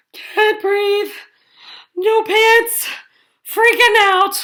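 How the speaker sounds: high, breathless panicky voice; panicky squeak. A narrator's reading. Frightened figure squeaking at the pantsless wolf, panicky.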